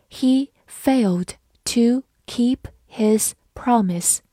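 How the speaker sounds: slow, each word set apart; dictation-like pace with pauses between words; slow reading. Shown as a demonstration. Teacher models the example sentence for learners, slowly. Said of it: "好，慢慢来读".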